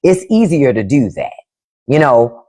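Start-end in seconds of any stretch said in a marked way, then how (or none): none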